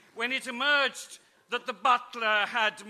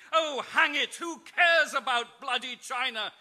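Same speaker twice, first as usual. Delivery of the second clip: mock exasperated aristocrat, high and louder; hammed-up bored outburst; impatient lord; 6 semitones higher, 7 dB louder than usual. Lord Guy to Amelia about the vase, mock impatient. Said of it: "sighed Lloyd, Lord Guy impatiently".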